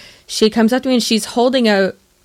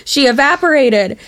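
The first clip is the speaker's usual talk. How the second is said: mock terrified; 5 semitones higher than usual. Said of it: "our friend was terrified".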